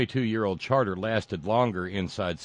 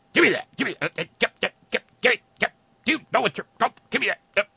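high, squeaky panicked grabbing; frantic flag-snatcher; 5 dB louder, much faster than usual. Acted. Host acting out CPAC staff snatching flags, frantic squeal. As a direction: splutter it fast and much higher, in a flustered fit.

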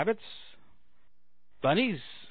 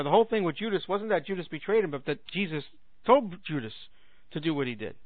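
ordinary talk, nothing marked

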